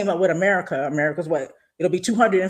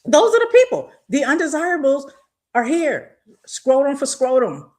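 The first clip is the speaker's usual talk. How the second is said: sudden loud outburst; 6 semitones higher, 4 dB louder than usual. Real, not acted. Emphatic sermon-like rant.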